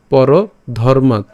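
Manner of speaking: very slow পরধর্মাৎ, closing on the t; deliberate "paro... dharmaat" for পরধর্মাৎ; stopped final t. Shown as a demonstration. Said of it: "ত হসন্তের মতো হয়".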